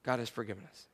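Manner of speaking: hushed reverent echo; near whisper. Real, not acted. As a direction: breathe it out to the room. Echoed softer still.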